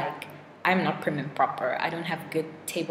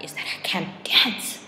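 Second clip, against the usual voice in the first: whisper; 3 semitones higher, 3 dB louder, slower than usual. Real, not acted. Confessing she can't dance.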